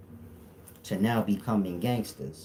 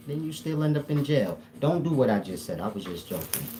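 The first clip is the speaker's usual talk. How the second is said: ordinary delivery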